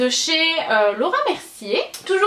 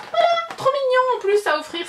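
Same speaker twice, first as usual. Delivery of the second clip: delighted; 12 semitones higher than usual. Real, not acted. Gushing over a cute gift box.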